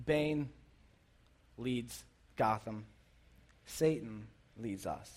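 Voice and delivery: low, slow, soft and grave; low, slow ominous parallel; low ominous hush. Real, not acted.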